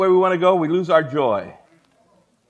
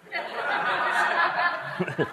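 breathy laugh; 6 semitones higher, 8 dB quieter than usual. Real, not acted. Laugh after joke line.

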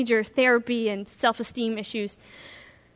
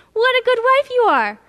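gushing husband; 10 semitones higher, 6 dB louder than usual. Retold or imitated. Husband praising wife, story.

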